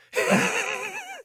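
high wheezy gasping laugh; gasping old man laugh. Shown as a demonstration. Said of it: "Like that".

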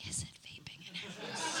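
slow, unsure whisper; hushed comic question, drawn out. Acted. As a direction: whisper it like a secret check.

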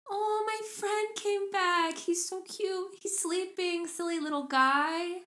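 put-on high, slow, sweet child voice; little boy. Little boy greeting his sparrow friend, high child voice. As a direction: coo it like an innocent little boy. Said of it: "the little boy is like".

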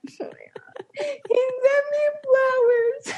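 high, slow mock sob; imitating crying. Friend mimics her sobbing about flowers, slow high wail. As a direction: blubber it out slowly. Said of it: "And I'm like".

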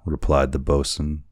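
usual speaking voice

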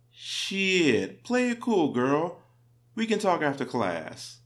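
slick, playing it cool; cocky kid voice. Student's cheeky retort quoted.

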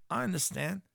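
ordinary talk

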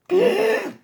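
sharp high mock gasp; dramatic gasp. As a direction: gasp as if shocked. Dramatic gasp at the toilet horror.

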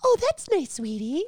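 very high, sweet mock-mom falsetto; Cartman's mom. Teacher forced to indulge a threatening kid, cooing mom impression. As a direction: coo it like an indulgent cartoon mother. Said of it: "They have to be Cartman's mom".

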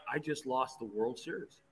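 sinking realization, said to himself; inner thought. Retold or imitated. Pitcher watching the closer warm up, quiet dread. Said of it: "And in my head, I'm like".

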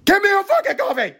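shrieking demand for coffee; furious shout. Retold or imitated. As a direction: lose it completely at the barista. Customer finally snaps at barista.

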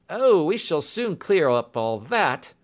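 high, loud, slow inspector voice; drawn-out, confident character voice. A narrator's reading. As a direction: brush the doubt aside with authority. Voices Bradstreet.

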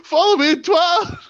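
high, loud cartoon shout; mock shout. Host shouting a joke plug in a put-on voice. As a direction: bellow the plug comically.

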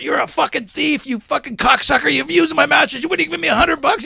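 high-pitched mock tirade; retold losing it. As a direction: imitate a friend flying off the handle. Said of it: "Buddy's losing at this part".

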